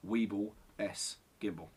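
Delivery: ordinary talk, nothing marked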